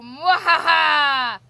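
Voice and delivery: slow booming evil cackle; sneering panto villain. Acted. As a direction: gloat with a big villain laugh. Villain taunting in game.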